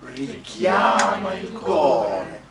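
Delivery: loud, very slow "richiama il cuore", weight on CHIA and CO; stress on CHIA, CO. Shown as a demonstration. Coach leading the whole line for singers, stress on CHIA and CO. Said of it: "The accents are on chia and co".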